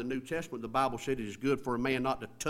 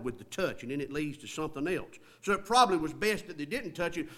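ordinary talk

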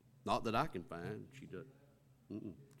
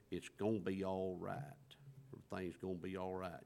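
hushed, whispered reassurance; soothing whisper; 4 semitones lower, 7 dB quieter than usual. Retold or imitated. Steady friend soothing someone falling apart, whispered. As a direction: breathe it out softly to calm them. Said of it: "they're there just to say".

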